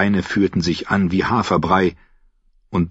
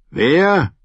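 gruff guard; 9 semitones higher than usual. Acted. Guard dismisses the knight at the gate. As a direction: brush off the drunk.

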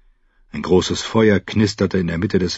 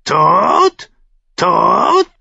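raven cry; 14 semitones higher, 6 dB louder than usual. Acted. Raven repeats the word.

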